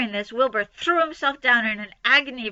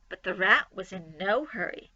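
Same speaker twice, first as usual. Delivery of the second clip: ordinary delivery, nothing marked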